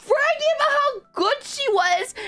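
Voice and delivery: high-pitched, incredulous scolding; retold exasperation. Retold confrontation of bragging friend.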